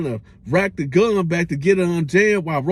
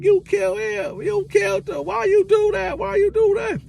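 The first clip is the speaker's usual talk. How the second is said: put-on much higher, distraught wailing girl; hysterical girlfriend. The girl screaming at Roach over her shot boyfriend. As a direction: play her panicking over the body.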